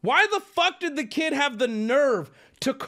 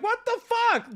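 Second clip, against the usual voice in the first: much higher, playful outrage; half-joking yelp; 13 semitones higher than usual. Retold or imitated. Customer yelping at the worker, half joking. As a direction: squeak it in jokey disbelief. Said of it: "half joking".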